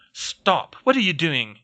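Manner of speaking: plain reading voice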